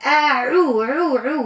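عو repeated with a pressed ain; syllable drill on the ain. Shown as a demonstration. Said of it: "فما عين حرف يظهر".

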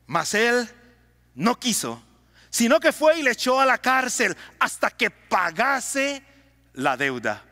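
usual speaking voice

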